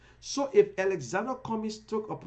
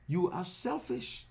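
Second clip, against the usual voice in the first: normal talking voice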